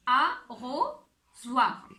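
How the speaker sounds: arrosoir stretched out very slowly, each syllable held; slow, drawn out. Shown as a demonstration. Teacher slowly stretching arrosoir for children to hear the sound. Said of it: "où est-ce qu'on entend le son O?".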